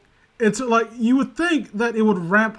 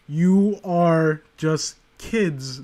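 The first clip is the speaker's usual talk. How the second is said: slow blunt; much slower than usual. Real, not acted. Lecturing fans bluntly.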